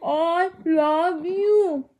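doting, stretched-out vowels. Real, not acted. Cooing love over baby's cries.

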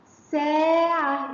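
ساعة with a very long first aa; long vowel. Shown as a demonstration. Teacher shows seen with alif madd, ساعة slowly stretched. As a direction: draw the alif of ساعة right out. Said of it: "مسكت المد بالألف".

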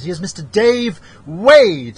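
announcer intro. Acted. Host introduces his co-host with a big emcee call. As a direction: roll out the name like a ring announcer.

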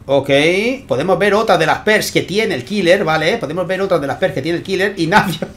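lively esports caster calling the action; energetic game commentary; live play-by-play. Acted. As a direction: call the match as it unfolds for the viewers. Live casting of tournament match.

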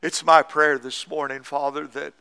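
a hushed pastor's prayer after the sermon; closing prayer. Acted.

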